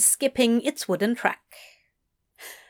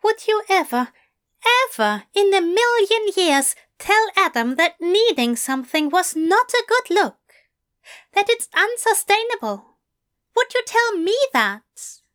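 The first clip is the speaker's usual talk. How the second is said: higher, pressing and stern; firm, reproachful angel; stern Aziraphale; 11 semitones higher, 4 dB louder than usual. Acted. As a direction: challenge him gently but firmly. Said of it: "stern look".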